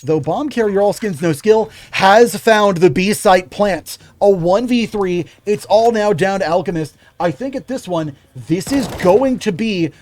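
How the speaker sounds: energetic esports caster voice; play-by-play commentary. Acted. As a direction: call the round like a live caster. Casting live esports match.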